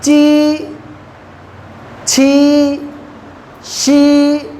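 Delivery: ची, छी, शी each said crisply and apart; ची छी शी as a teacher's drill; Chinese syllables demo. Shown as a demonstration. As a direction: sound out ची, छी, शी slowly for the class. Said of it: "कौन सा letter मैं लगा रहा हूँ".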